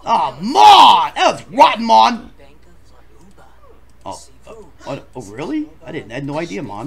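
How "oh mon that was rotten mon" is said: loud, disgusted outcry at Mon; shouted complaint. Real, not acted. Complaining to Mon about a rotten smell, loud. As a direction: yell it at the culprit.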